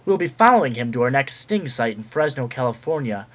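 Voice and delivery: dramatic TV crime teaser; news announcer. Acted. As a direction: read it like a Dateline promo. Mock Dateline news teaser.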